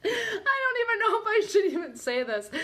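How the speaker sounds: high voice breaking up with laughter; laughing too hard to say it properly; embarrassed, high-pitched giggle; real giggling. Real, not acted. Embarrassed to read the next term.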